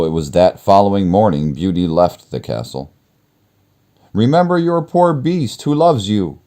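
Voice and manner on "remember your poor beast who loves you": much higher, pleading plea; pleading cry. Acted. Beast pleading as Beauty leaves the castle. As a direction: beg her to remember him. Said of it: "cried the beast".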